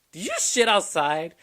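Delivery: mock shocked, stretch out the question; incredulous question. Asking his girlfriend what she did outside, incredulous.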